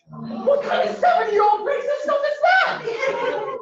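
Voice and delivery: very high, stretched-out yell; incredulous yell. Acted. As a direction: cry out in outraged disbelief, drawn out and high. Comedian reacts, high-pitched shouted punchline.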